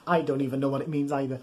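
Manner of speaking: ordinary delivery, nothing marked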